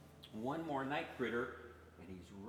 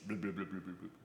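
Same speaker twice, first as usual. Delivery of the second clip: stream babble; 6 semitones lower, 7 dB quieter, much faster than usual. Acted. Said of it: "The babbling stream".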